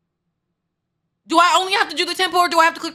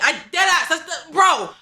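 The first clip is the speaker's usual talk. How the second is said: normal talking voice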